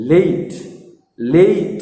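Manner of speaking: লেট with an "ei" glide before t; long, gliding vowel in লেট; added i sound. Shown as a demonstration. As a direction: slip an "ee" into the middle of লেট. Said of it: "ই উচ্চারণ করবে".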